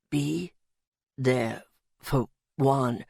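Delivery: very low, slow, broken-up words; dying, halting speech. A narrator's reading. Dying Louis pleading from the rubble, low halting strain. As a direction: labour out each word with long gaps.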